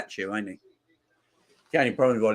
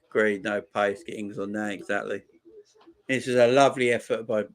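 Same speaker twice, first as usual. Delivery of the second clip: ordinary talk, nothing marked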